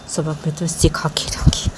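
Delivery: hushed vengeful whisper; whispered threat. Acted. Nisha vowing revenge on Manisha, whispered. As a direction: breathe the vow under your breath.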